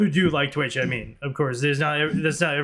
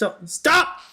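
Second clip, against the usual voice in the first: exasperated yell; 9 semitones higher than usual. Real, not acted. Yelling at glitching model.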